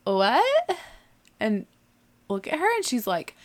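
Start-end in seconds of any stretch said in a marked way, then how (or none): 0.0-0.8 high, loud rising "what?"; acting out a bewildered squeak; totally lost, pitched up; baffled. Baffled by the clerk's question.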